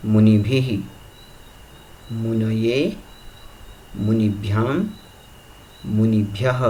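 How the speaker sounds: each case form spaced out by pauses, ending on a slight rise; recitation drill repeated. Shown as a demonstration. Teacher repeating muni forms for students to follow, careful chant. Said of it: "एक बार फिर उच्चारण कर रहा हूँ".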